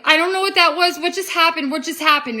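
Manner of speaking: high, loud, bewildered outburst; retold panic. She answers her friend after running out, in retold panic. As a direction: act out frantic confusion.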